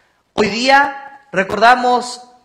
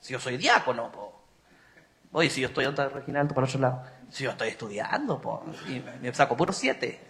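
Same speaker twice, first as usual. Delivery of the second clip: low, quiet, smug put-on voice; mock a proud deacon lowly and softly; mocking self-important cleric; 8 semitones lower, 6 dB quieter than usual. Priest parodying a smug cleric to the congregation, low mocking voice. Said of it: "Yo bromeaba ayer".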